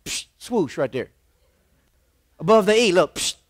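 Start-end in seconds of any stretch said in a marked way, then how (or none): 0.0-1.1 low whooshing psh; swoosh sound. Shown as a demonstration. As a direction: make the Nike swoosh noise. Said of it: "with a little".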